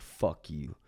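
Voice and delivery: low, quiet "fuck you"; straight-to-the-face curse. Retold or imitated. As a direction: drop it softly, right to his face. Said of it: "straight to his face".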